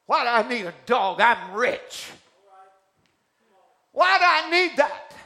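high loud yell of contempt; scornful shout. Acted. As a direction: shout him down, full of scorn. Miner rants before shooting dog.

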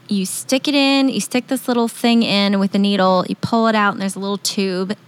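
ordinary delivery

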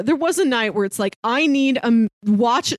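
ordinary talk

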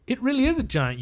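usual speaking voice

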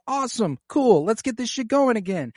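lightly voiced eager teen; mock gung-ho reply; eager Jace. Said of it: "and Jace is like".

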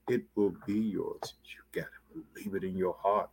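hushed whisper to the flock. Acted. Preacher whispering the promise to the congregation, hushed. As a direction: lean in and breathe the words. Preacher drops to hush after verse.

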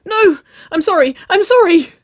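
very high, loud squeaky apology; panicked squeal. A narrator's reading. Waverley caught by her girlfriend, squealing in mock panic. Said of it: "she squeaked".

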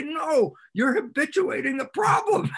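loud, high scolding cry; exasperated shout. Retold or imitated. Telling the client he is feeding the problem, loud and exasperated.

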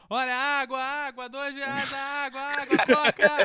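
high, loud hawker calling out drinks; street vendor shouting. Retold or imitated. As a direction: cry your wares over the crowd. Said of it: "um camelô gritando".

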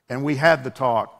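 normal talking voice

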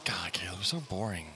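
soft whispered teasing jab; whispering. Real, not acted. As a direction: whisper the tease.

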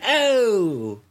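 high, drawn-out "oh"; catchphrase "oh". Acted. Arguing over Gallagher's catchphrase, high mimicked "oh". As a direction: mimic a comedian's catchphrase yelp. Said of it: "Gallagher doesn't have an".

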